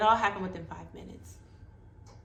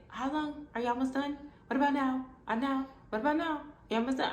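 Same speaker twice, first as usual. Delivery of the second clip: high, repeated impatient questions; anxious pestering; 6 semitones higher than usual. Retold or imitated. Scared patient during stitching, anxiously pestering. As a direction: keep nagging for an answer. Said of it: "I was so scared".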